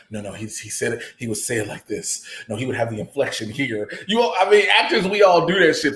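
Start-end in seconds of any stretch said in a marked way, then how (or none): 0.1-3.9 soft, low muttering to yourself; muttered rehearsal. Retold or imitated. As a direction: mumble it like an actor rehearsing alone. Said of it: "I've done that so many times".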